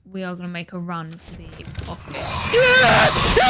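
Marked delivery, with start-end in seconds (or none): 1.9-3.4 scared scream. Real, not acted. Jump scare while running.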